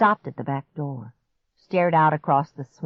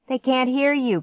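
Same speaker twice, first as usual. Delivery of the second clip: raised, high urgent voice; shouting; 8 semitones higher than usual. A narrator's reading. Boy shouts at girl by door, urgently. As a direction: shout over the panic. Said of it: "Clark shouted".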